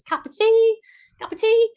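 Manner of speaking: high, loud mock Cockney cup of tea; squeaky over-the-top Cockney; mock Cockney accent. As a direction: put on a cartoonish Cockney accent. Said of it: "they do their Cockney accents".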